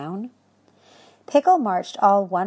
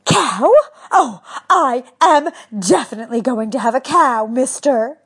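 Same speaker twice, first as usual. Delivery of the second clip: surprised; 6 semitones higher, 8 dB louder than usual. Acted. Character exclaims in shock.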